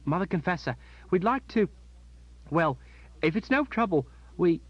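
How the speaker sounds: put-on high, halting child's voice, losing nerve; a small boy gulping air before the Mother Confessor; nervous boy. Boy asking the Mother Confessor a favour, nervously trailing off. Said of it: "his voice trailed off as he gulped air".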